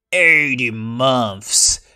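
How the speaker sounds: drawn-out, heavily stressed "Eighty months"; stressed slow. Real, not acted. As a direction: stretch out "Eighty" in disbelief. Complaining about infamy duration.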